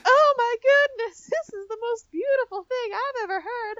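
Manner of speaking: very high, gushing princess voice. Retold or imitated. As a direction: swoon in a sugary high falsetto.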